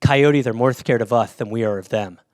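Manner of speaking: low lisping imitation; lisped, low mock reassurance; reassuring friend. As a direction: mimic a friend calmly reassuring, with a lisp. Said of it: "No, he goes".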